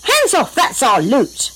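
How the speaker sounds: high-pitched indignant holler; excited storybook yell; yelled warning. A narrator's reading.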